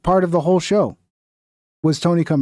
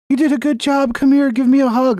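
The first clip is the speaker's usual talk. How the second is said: higher-pitched, patronizing fake praise; mock-supportive; 8 semitones higher than usual. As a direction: coddle them in a high, sing-song mockery. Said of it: "that's what the audience wants".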